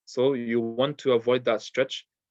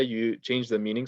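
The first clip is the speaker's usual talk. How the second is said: ordinary talk, nothing marked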